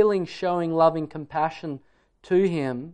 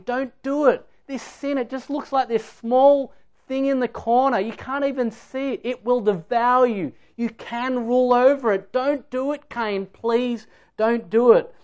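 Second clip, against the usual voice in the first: higher-pitched, insistent plea; urgent pleading; 5 semitones higher than usual. Acted. God urging Cain before the murder, pleading. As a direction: urge him earnestly to stop before it's too late. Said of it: "urging Cain".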